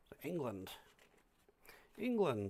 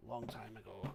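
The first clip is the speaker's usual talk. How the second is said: softer muttered repeat of Long time ago; quiet echo; 3 semitones lower, 8 dB quieter than usual. Real, not acted. Collector repeating his own remark, quiet mutter.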